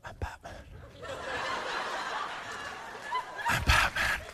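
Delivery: husky mock Batman rasp. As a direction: growl it like the caped crusader. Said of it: "I'll look in the mirror and I'll say".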